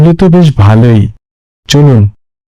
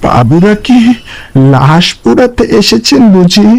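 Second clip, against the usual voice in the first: much higher, uncanny ghostly voice; eerie stranger; 10 semitones higher than usual. Acted. Mysterious stranger greets the friends at the pyre, high and uncanny. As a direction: sound sly and otherworldly. Strange figure at cremation ground.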